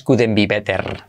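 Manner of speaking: couldn't be better with Spanish vowels and r; Spanish-accented mistake; wrong Spanish accent. Shown as a demonstration. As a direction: say it badly, Spanish-style. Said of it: "pero nunca digas".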